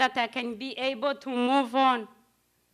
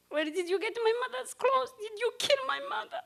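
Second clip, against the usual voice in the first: much higher, anguished questioning; desperate accusation; 10 semitones higher, faster than usual. Retold or imitated. To the woman in her mother's clothes, a high anguished demand. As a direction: demand it, voice climbing in panic.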